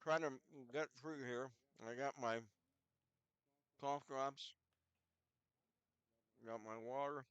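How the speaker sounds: usual speaking voice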